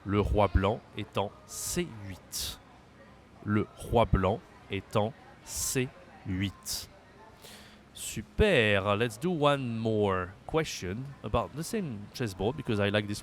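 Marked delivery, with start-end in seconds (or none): 0.0-6.9 "le roi blanc est en C huit." spoken slowly; slow careful French; slow model answer. Shown as a demonstration. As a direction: spell it out slowly for learners. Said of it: "We say".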